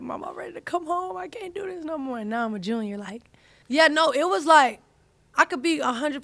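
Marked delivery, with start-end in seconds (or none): 0.0-2.2 high, soft whimpering plea to mom; a small tearful voice begging to go home; imitating her crying self. Daughter on the phone to her mom, tearful whimper.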